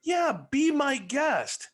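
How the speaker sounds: high, drawn-out mock of a wooden line; hammed-up stiff line. Mocking Gary's wooden reply to the girl, slow and high. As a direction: drag out the stiff line like a bad actor. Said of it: "he's like".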